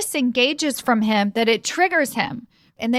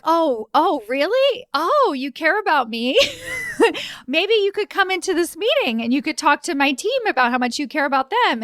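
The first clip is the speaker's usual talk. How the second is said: sarcastic; 6 semitones higher than usual. Retold or imitated. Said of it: "Then I'm like".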